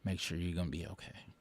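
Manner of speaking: whispered, dripping sarcasm; sarcastic whisper. Retold or imitated. Whispering sarcastic reply to Constitution fans. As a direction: hush it out with mock admiration. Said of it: "I be like".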